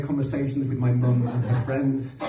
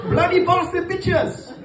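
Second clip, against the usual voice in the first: high, slow dad voice; Pakistani dad catchphrase; slower than usual. Retold or imitated. Comic imitating his dad's favourite insult, high and slow. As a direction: drag out each insult like an old dad. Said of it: "That was his go-to phrase".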